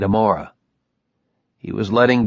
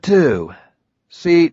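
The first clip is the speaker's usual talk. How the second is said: ordinary delivery, nothing marked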